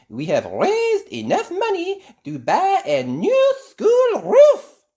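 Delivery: high, loud, rising joy; excited cry. A narrator's reading. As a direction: announce with delight. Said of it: "she cries".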